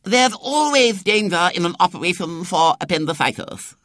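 high, pompous doctor's pronouncement; portentous. A narrator's reading. Doctor impressing an admiring young woman, portentous. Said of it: "said Doctor Ashted portentously".

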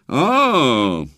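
knowing, sardonic. A narrator's reading. Sceptical doctor reacts to boy's yell.

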